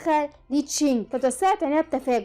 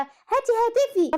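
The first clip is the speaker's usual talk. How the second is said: angry demand; 9 semitones higher, much slower than usual. Acted. Grabs phone, demands it back.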